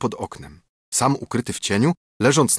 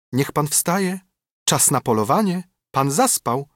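wake-up call; 6 semitones higher than usual. A narrator's reading. Voice calling the sleeper to hunt.

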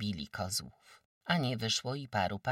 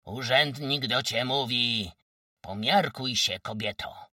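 louder official warning; a touch of menace; 3 semitones higher, 7 dB louder than usual. Acted. The wójt warning Kozłowa, mildly menacing call. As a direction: warn her as an official. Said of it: "zawołał groźnie".

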